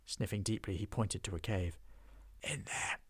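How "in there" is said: hushed whisper; whispered pointer. Acted. Dwarf whispering to the pair outside the troll cave.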